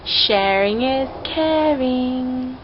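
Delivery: very slow, stretched singsong slogan; slow-motion catchphrase delivery; drawn-out motto. Shown as a demonstration. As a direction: stretch each word out playfully.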